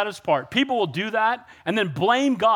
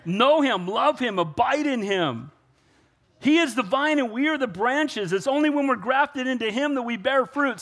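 rising fervent sermon delivery; preaching; 6 semitones higher than usual. Acted. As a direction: preach it out to the congregation. Preaching at full force.

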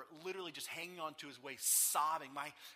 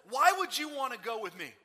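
tirade; 7 semitones higher, 12 dB louder than usual. Acted. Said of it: "goes on this tirade".

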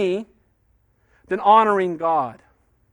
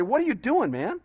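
frustrated king; 5 semitones higher than usual. Retold or imitated. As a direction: lean on more like a desperate offer. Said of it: "Balak's trying to say".

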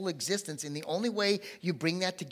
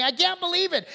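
high, loud anguished cry; Thomas despairing; 14 semitones higher, 10 dB louder than usual. Acted. Preacher voicing Thomas's despair, high and loud. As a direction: cry it out like a broken man.

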